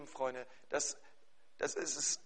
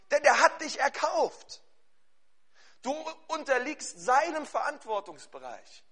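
raised, high-pitched proclamation; emphatic pulpit delivery, driving the message home; forceful preaching; 6 semitones higher, 11 dB louder than usual. Real, not acted. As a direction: preach it loud and urgent.

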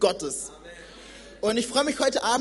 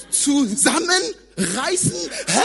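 higher, louder, slow puzzled "Zusammenreißen?"; baffled question; 6 semitones higher, 5 dB louder, much slower than usual. Acted. Preacher mocks the odd idiom, baffled and rising. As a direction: repeat the word in disbelief.

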